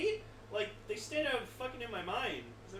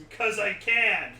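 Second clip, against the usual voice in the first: smug; 3 semitones higher, 9 dB louder, slower than usual. Retold or imitated. Mocking writer's ego.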